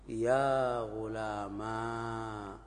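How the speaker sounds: very slow يا غلاما with a long final a; resulting form demo. Shown as a demonstration. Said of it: "فيصير". (نطق يا غلاما ببطء شديد)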